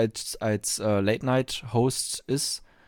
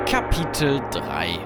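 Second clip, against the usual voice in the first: slow, low announcer voice; chapter announcement; 6 semitones lower, slower than usual. Acted. Host opens the next chapter, low slow announcement. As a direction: announce it ponderously, pitched down.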